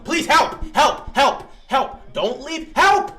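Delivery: begging for help as the lead slips away; panicked pleading. Real, not acted. Desperate fan pleading while watching the game.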